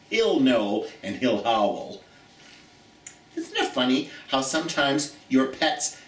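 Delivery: ordinary delivery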